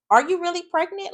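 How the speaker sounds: very high-pitched skeptical question; exaggerated, squeaky doubt; mock disbelief. Doubts the pregnancy claim.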